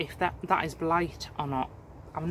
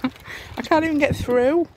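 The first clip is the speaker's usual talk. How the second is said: amazed disbelief; 9 semitones higher, 5 dB louder than usual. Real, not acted. Laughing, stuck in overgrowth.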